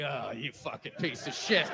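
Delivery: low, quiet self-loathing mutter; disgusted self-talk. Retold or imitated. To his own reflection at morning, low disgusted mutter. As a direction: sneer at yourself in the mirror. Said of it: "you looked up, you're like".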